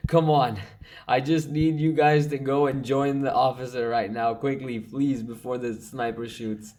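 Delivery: high, loud and pressing, willing them on; loud eager urging at his soldiers; excited urging. Real, not acted. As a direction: push hard, breathless with hope. Urging troops at key moment.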